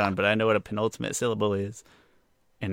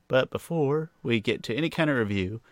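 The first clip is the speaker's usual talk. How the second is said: ordinary talk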